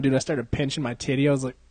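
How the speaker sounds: ordinary talk, nothing marked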